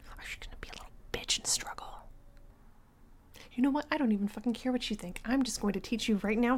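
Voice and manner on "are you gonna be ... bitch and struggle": hushed menacing whisper; threatening whisper. Acted. Dominant girlfriend threatening her boyfriend, whispered. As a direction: breathe the threat right at him.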